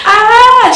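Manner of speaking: high, loud joyful cry; excited squeal. Real, not acted. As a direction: let out a delighted squeal. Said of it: "she was so excited".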